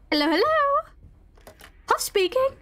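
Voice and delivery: high, drawn-out playful hello; mock phone greeting. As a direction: answer the phone in a put-on high voice. Playfully answers a phone in game.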